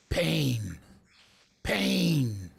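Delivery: slow, low menacing pain; a hint of Clubber Lang's menace. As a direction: growl it out like a boxer villain. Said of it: "I'm gonna be Clubber Lang".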